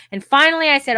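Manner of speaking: ordinary delivery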